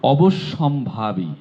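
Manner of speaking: অবশ্যম্ভাবী said very slowly, syllable by syllable; slow careful pronunciation. Shown as a demonstration. (ধীরে ধীরে অবশ্যম্ভাবী)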